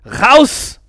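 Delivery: very loud high bellow; a man with a gun ordering him out; roar. A narrator's reading. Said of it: "brüllte ich".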